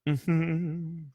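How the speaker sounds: high real laugh at own digression. Real, not acted. Reader laughing after drifting off topic. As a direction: break into a quick laugh. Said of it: "Sorry, I, uh, digress".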